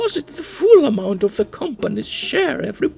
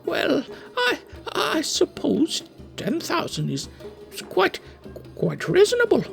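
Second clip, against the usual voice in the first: high, stammering forced agreement; nervous surrender. Acted. Cowed Khaled agreeing with the orc, high and stammering. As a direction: stammer out a scared yes.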